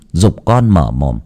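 ordinary delivery

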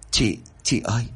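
lightly acted stammer, word repeated. A narrator's reading. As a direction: stammer out a hesitant call to your sister. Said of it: "Nhung cầm tay của Thu lắp bắp". (lắp bắp nhẹ)

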